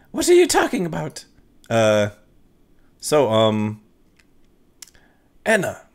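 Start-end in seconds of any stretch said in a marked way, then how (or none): 0.1-1.2 much higher woman's voice, puzzled; woman client. Acted. Anna asks the ghost what he means, higher woman's voice. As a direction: squeak it out as the confused client.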